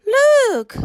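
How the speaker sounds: high, loud exclamation; a sharp, startled high cry; cried out. Acted. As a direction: call out in alarm to the other dwarfs. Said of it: "cried Grumpy".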